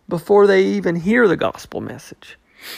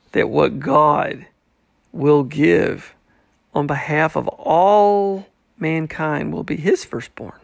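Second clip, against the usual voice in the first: usual speaking voice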